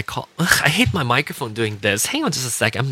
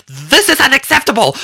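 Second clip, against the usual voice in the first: high, louder mock yell; shrill indignant outburst, much higher; reenacting yelling at the agent; retold yell; 6 dB louder than usual. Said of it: "I yelled at her".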